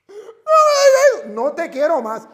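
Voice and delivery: high, loud wailing no no; crying plea. Retold or imitated. Catechist crying as the priest expels her, high wailing plea. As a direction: beg him not to throw you out.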